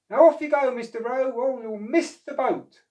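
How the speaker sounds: high, loud playful send-off; cheerful shooing. Acted. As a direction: hurry Mister O to his boat. Oscar shooing Mister O.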